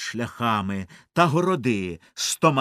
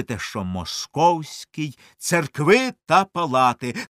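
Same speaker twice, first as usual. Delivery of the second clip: ordinary delivery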